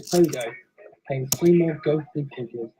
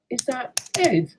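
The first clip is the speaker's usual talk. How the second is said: ordinary delivery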